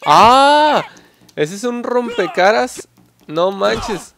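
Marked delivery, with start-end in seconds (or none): 0.0-0.8 loud high scream of shock; a sudden full-throated yell; real scream. Real, not acted. Gamer hit by a face-smashing kick, real scream. 1.3-2.9 stunned. Real, not acted. Reacting to the big hit.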